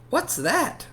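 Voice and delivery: high, surprised question; curious girl. Acted. Girl asking a new boy about his food, high voice. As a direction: ask it wide-eyed. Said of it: "asks Madison".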